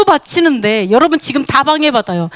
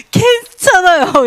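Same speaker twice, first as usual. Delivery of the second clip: drawn-out sweet reassurance; 6 semitones higher, 3 dB louder, much slower than usual. Acted. Believer content with little.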